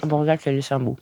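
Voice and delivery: talking through a bite of tart; words round a mouthful; mouth full. Real, not acted. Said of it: "je vais parler avec la bouche pleine".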